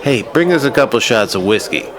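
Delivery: calling out. A narrator's reading. Theo turning to the bartender to order shots, calling out.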